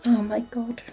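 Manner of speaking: exasperated whisper under the breath. Real, not acted. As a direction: breathe it out in disbelief. Exasperated aside.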